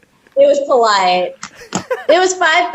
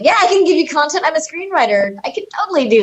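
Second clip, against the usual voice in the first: eager husband; 7 semitones higher, much faster than usual. Retold or imitated. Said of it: "And he's like".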